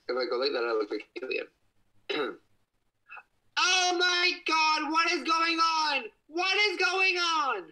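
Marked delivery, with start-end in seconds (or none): 3.6-7.6 very high chipmunk-pitched shout; squeaky filter voice. Pretend. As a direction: shriek it in a tiny high cartoon voice. Reacting to filter voice on phone.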